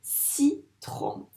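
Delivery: teacher sounding out "Citron" in two beats; split into syllables. Shown as a demonstration. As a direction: separate ci from tron in "Citron". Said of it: "c'est dans la première".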